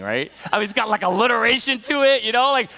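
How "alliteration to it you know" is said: ordinary delivery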